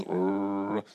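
deep, low imitated dog growl; rumbling mock growl of a dog. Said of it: "The dog goes".

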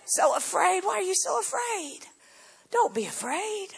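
tender reassurance. Real, not acted. Pastor gently reassures fearful listeners.

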